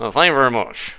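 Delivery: [name] voice. Pretend. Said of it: "That was a touch more [name]".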